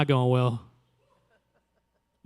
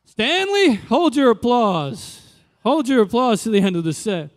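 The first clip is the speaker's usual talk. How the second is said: higher, louder slow hold; mock stage command; 7 semitones higher, 4 dB louder than usual. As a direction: hold the crowd back, hammed up. Mock crowd-work to audience.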